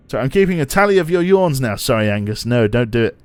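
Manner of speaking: normal talking voice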